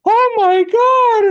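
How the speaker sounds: drawn-out, piercingly high mock scream; panicked shriek, imitated. Old woman in hospital sees the elbow, imitated panicked shriek. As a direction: wail it loud in exaggerated horror. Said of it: "almost the exact same response".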